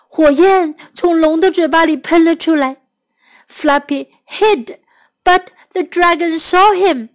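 usual speaking voice

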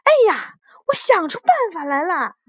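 high, fast exclamation of a sudden idea; excited cry. Acted. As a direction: cry out as a mother struck by inspiration. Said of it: "妈妈大叫".

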